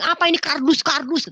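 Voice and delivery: high, scornful retort; angry mother. Retold or imitated. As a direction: act out a mother's contemptuous outburst. Angry mother smashing the picture.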